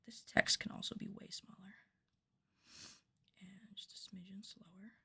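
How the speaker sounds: hushed, breathy aside; soft whispered aside to the crew. Real, not acted. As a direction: whisper so the mic barely hears. Whispering aside during filming.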